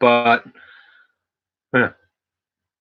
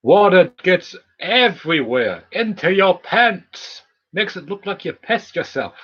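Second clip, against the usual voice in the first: higher, slow, hammed-up dramatic voice; mock dramatic voice; 9 semitones higher than usual. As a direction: play it up high and drawn out. Nah, I'm just kidding.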